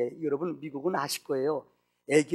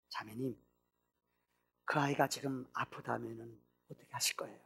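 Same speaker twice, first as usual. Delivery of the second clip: gentle question; 4 semitones lower, 11 dB quieter than usual. Acted. Pastor asks gently.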